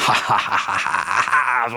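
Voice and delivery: hams up a wicked gloating laugh; evil laugh. Retold or imitated. Preacher mimics the wicked rejoicing over the witnesses, mock evil laugh. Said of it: "It's an evil rejoicing".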